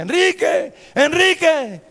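high, loud repeated calls; insistent shouting. Retold or imitated. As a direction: keep shouting until he answers. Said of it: "insistía".

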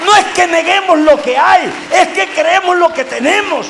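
high, sustained preaching shout; full-force preaching. Real, not acted. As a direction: cry it out to stir the congregation. (predicación a voz en cuello)

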